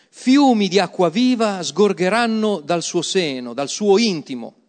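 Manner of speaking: loud, high proclamation of Jesus' cry; Jesus exclaiming. Acted. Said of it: "esclamò". (Gesù che esclama a gran voce, forte e acuto)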